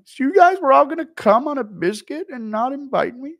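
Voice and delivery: high, loud whiny outrage; Schmitty impression. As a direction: whine like the one left out. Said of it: "Schmitty left out".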